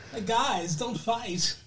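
small, timid-sounding celebrity plea; Christopher Walken. Acted. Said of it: "I invited Christopher Walken to the studio".

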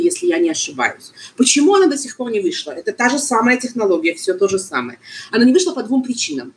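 usual speaking voice

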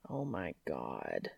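very soft, slow, awestruck; awed hush. Acted. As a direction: breathe it out in reverent wonder. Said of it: "stroking his fingers reverently".

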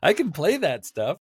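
high, loud mock plea; hammed-up, high-pitched appeal with a laugh in it; pleading actor. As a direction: imitate an actor begging for a shot. Acting the pleading actor's quote.

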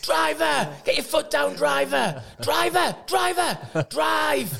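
put-on high-pitched drunken-lads holler; mock shouting. Drunk players shouting at the bus driver, high imitated yelling. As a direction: yell repeatedly down the bus in a high voice. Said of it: "We used to shout at the driver".

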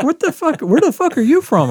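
a high, laughing imitation of shocked coworkers; mock disbelief. Coworkers baffled by the newcomer, high mock disbelief. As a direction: play the stunned coworkers, half laughing. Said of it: "they're like".

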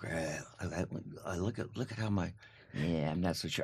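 low, very soft mumbled dissatisfaction; complaining about headshot. Retold or imitated. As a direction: imitate a picky actor doubting the photo, softly. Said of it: "complaining about their headshot".

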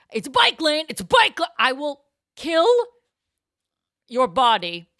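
high, loud yelled threat; mock yell. Pedestrian yelling back at the cyclist, loud mock rage. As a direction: roar it after him. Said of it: "vault him into traffic".

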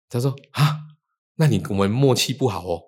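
ordinary talk, nothing marked

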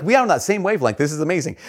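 high, gushing fan; acted-out amazed delight; starstruck excitement. Thrilled at shared dog name.

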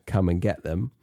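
usual speaking voice

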